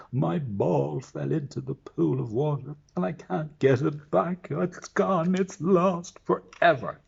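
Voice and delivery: high, loud tearful princess, slowing at the end; the princess wails over her lost ball; wailing princess. Acted. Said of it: "said the princess".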